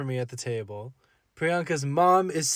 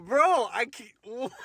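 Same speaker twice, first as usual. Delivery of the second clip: high, slow, laughing protest; laughing disbelief; 9 semitones higher, much slower than usual. Retold or imitated. Date stunned by dad's request, laughing and high. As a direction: laugh through the refusal. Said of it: "I said".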